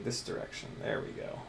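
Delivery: soft breathy whisper. Real, not acted. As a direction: murmur to yourself. Quiet working aside.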